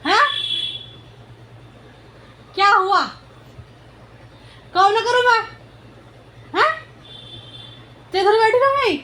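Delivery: high, loud mock-exasperated cooing; imitating her baby talk. Mother acts out how she coos at her crying daughter, high and loud. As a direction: act out pleading with the crying baby. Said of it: "और जैसे ही उठ कर होंगी".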